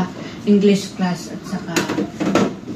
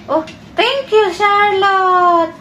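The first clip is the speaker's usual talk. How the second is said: delighted thanks; 9 semitones higher, much slower than usual. Real, not acted.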